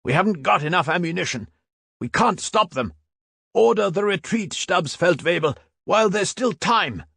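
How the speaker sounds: high, fast pleading shout; urgent shouting. A narrator's reading. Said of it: "shouted the veteran even louder".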